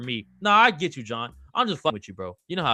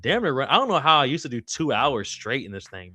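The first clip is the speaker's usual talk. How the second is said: ordinary talk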